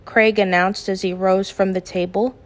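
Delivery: usual speaking voice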